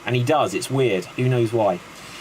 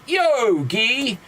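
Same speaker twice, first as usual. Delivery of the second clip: high, hammed-up greeting; mock hailing; 7 semitones higher than usual. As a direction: call out high for the pun. Bartender hailing in retold joke.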